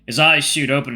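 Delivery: plain reading voice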